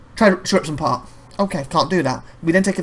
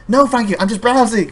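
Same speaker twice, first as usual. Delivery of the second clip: louder, higher, fed-up "no thank you"; irritated refusal; 6 semitones higher, 5 dB louder than usual. Retold or imitated. Customer brushing off a clerk again, louder and irritated. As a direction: brush off the clerk a second time. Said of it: "Five minutes later".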